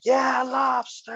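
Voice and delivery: high, drawn-out hipster holler; mock loud cheer. Young hipsters in the front cheering, mock shout. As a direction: imitate rowdy fans yelling, stretched out. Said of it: "They're, like, all fucking loud".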